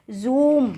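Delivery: "जूम" read as zoom with a z; buzzed z. Shown as a demonstration. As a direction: buzz the opening of "जूम" as zoom. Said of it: "पढ़ते भी". ("जूम" को ज़ूम पढ़ें)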